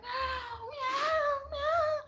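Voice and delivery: high, wailing cat meows; cat in heat meowing. Retold or imitated. Cats in heat wailing for help, high meows. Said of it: "they're all like".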